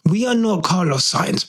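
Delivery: normal talking voice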